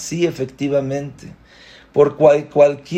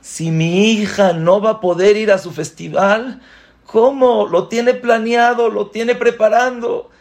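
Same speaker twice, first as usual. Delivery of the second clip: much higher, distressed retelling of the wife; worried wife; 9 semitones higher, 3 dB louder than usual. Retold or imitated. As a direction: sound like a frantic mother. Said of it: "Porque dijo". (esposa angustiada, voz mucho más aguda)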